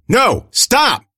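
high, loud frantic yell; desperate commanding shout. A narrator's reading. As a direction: yell to stop them. Alani yells at fleeing guards.

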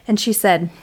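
usual speaking voice

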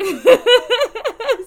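a big burst of real laughter; loud laugh. Real, not acted. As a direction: let out a loud, high laugh. Laughs at her own story.

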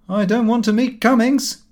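lively son's voice, pitched high and fast; a brash young man breaking bad news breezily. A narrator's reading. Son telling father the shares collapsed, brash and high.

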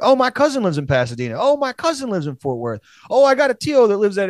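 rising mocking impression of a friend; teasing mimicry, pitch climbing; mock Edgar voice. Mocking Edgar's many cousins.

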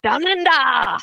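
joking attempt at an Australian accent; mock Australian accent. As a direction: echo the phrase in a wobbly Australian accent. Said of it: "neither were Australian".

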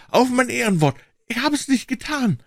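high-pitched tearful pleading; sobbing. A narrator's reading. Accused man gives his word of honour, sobbing. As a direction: swear it in a high, sobbing wail. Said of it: "schluchzte er".

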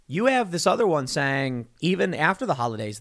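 ordinary talk

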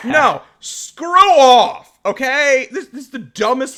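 very slow, loud, high screw off; mock outrage. Real, not acted. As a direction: stretch it in fake fury.

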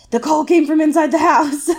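high, loud hammed-up panic; mock horror. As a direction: cry it out like a horror-film victim. Mock horror line voiced in unison.

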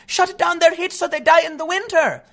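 high-pitched mocking impersonation; put-on high activist voice; mock Greta Thunberg. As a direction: mimic an activist on a high, droning note. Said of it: "I know she's not directly saying".

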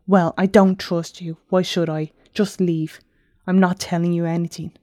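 usual speaking voice

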